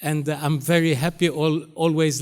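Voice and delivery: ordinary talk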